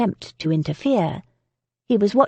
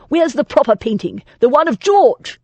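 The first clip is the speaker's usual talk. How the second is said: angry demand; 6 semitones higher, 5 dB louder than usual. A narrator's reading. Lord angrily demanding.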